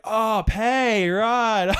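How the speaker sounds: loud, high "oh, I get it"; dawning realisation. Acted. Shop worker finally understands customer, hammed-up realisation. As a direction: act the penny dropping, loudly.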